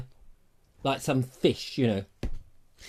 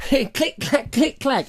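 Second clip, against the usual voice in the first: fencing clicks; 8 semitones higher, slower than usual. Retold or imitated. Sword fencing noises.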